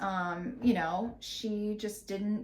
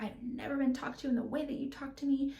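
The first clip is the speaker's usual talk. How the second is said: lightly voiced, higher imitation of a partner going off; a hint of the offended business partner; mocking the ranting partner; 7 semitones higher, faster than usual. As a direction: mimic her indignant rant, half-mocking. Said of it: "just going off and off and off".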